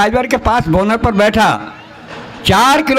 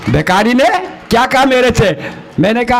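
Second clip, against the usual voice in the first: ordinary talk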